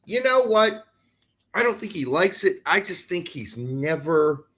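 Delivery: ordinary talk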